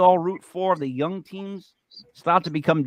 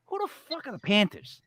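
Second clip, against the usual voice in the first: imagined scoffing retort; 10 semitones higher than usual. Retold or imitated. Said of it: "turn around and be like".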